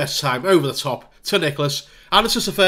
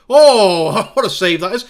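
surprised; 3 semitones higher, 10 dB louder, slower than usual. Real, not acted. Great save on screen.

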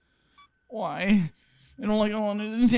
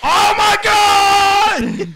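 startled scream; 12 semitones higher, 15 dB louder, much slower than usual. Real, not acted. Jump scare hits player.

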